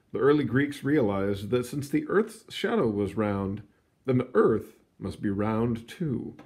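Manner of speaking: lively read-aloud storybook voice; story narration. A narrator's reading. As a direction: read it like a picture book to kids.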